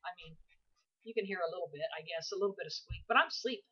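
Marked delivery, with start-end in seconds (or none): none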